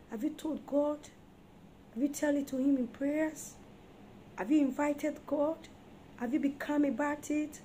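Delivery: normal talking voice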